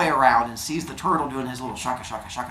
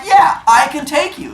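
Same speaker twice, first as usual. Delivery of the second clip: high, louder comeback; defiant turtle; 9 semitones higher, 5 dB louder than usual. Acted. Turtle answers the bully rabbit's race challenge, defiant. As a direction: rise to the dare, pitched up.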